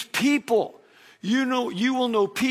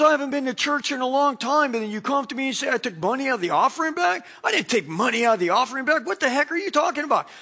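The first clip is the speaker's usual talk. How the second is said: higher, rushed mock indignation; indignant retort; 5 semitones higher, faster than usual. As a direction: act out an offended, fast-talking denial. Accused man's indignant retort retold.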